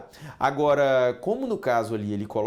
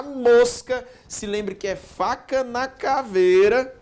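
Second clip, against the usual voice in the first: emphatic; 9 semitones higher, 7 dB louder, slower than usual. Real, not acted. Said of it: "eu quero que você acerte".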